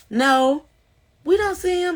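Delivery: high, puzzled no; reply in a lifted, unsure voice; mock police reply. Police answering her from outside.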